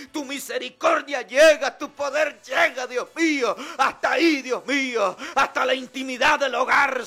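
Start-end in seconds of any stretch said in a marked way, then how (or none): none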